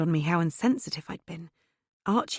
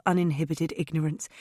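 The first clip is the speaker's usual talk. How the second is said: ordinary delivery, nothing marked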